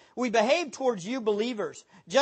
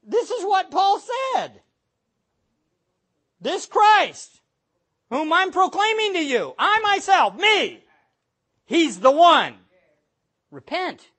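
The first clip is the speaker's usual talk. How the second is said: higher, louder fiery preaching; preaching at full force; 8 semitones higher, 8 dB louder than usual. Acted. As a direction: thunder the call to repent.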